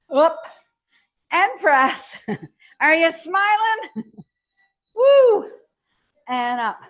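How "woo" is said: high, loud cheer; excited whoop. Real, not acted. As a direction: whoop with energy. Cheer after a rep.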